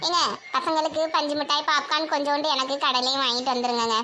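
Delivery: mother politely asking husband to bring snacks; mother's voice. Acted. Mother asks husband, much lower. (கணவரிடம் கேட்கும் அம்மாவின் தாழ்ந்த குரல்)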